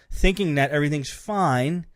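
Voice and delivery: ordinary talk, nothing marked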